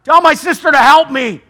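exasperated sister complaining and pleading for help; boiling over. Acted. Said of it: "She finally boils over".

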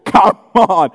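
loud, badly faked British accent; a hint of hammed-up British; mock British accent. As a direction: put on a clumsy British voice. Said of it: "there's a British accent involved".

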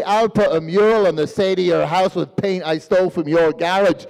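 exaggerated Northern Irish accent. Shown as a demonstration. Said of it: "to the Northern Irish accent".

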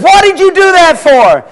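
high-pitched, acted outrage; hammed-up indignant professor; indignant protest. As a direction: protest in startled disbelief. Knocked-down professor protests to student.

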